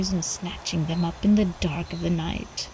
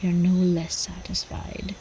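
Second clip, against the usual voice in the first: ordinary delivery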